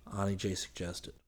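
usual speaking voice

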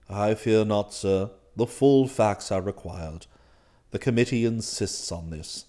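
low, soft, formal manservant voice; unruffled valet. Acted. Valet explains club rules to master, unruffled. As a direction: explain deferentially, deep and composed.

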